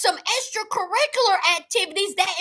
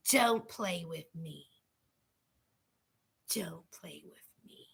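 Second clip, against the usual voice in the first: low, hushed mock warning; imitating Kate, hushed; 14 semitones lower, 14 dB quieter than usual. Retelling Kate's confessional, a low hushed mock threat. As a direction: mimic her quiet don't-play-with-me line. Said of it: "she kept talking about".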